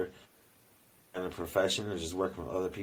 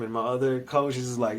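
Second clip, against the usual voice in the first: usual speaking voice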